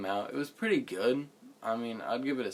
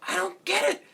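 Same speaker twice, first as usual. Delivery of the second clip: high, loud mock outburst; Nostalgia Critic; 9 dB louder than usual. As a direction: screech it in exaggerated bafflement. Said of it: "Nostalgia Critic impression".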